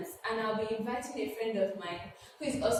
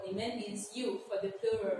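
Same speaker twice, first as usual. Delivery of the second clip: ordinary talk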